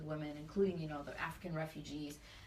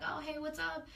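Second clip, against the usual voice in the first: lightly acted, high-pitched hello to a friend; breezy retold greeting, pitched up; casual phone greeting; 10 semitones higher than usual. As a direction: pick up the phone, voice lifted high. Retold cheerful answer to friend's call.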